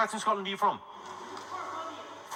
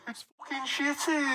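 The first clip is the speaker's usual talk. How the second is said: Birmingham accent; 9 semitones higher, 4 dB quieter than usual. Shown as a demonstration. Comedian giving a common Birmingham phrase, high Brummie accent. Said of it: "seems to come up a lot in Birmingham".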